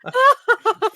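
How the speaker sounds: high, loud burst of laughter; helpless high cackle. Real, not acted. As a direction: crack up at the joke. Laughs at the radio joke.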